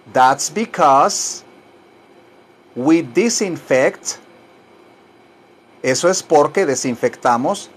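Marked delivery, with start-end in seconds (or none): 0.1-4.2 slow, spaced-out phrase for learners; slow model phrase. Shown as a demonstration. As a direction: say it slowly for them to repeat.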